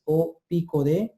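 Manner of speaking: ordinary talk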